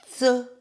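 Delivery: "tse" voiced as dzeu, high and loud; buzzed tse. Shown as a demonstration. Teacher demonstrates with Z, high voiced "tse". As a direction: model a loud "tse" with a z buzz. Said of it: "avec Z".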